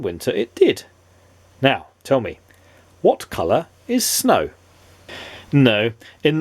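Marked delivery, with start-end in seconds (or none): none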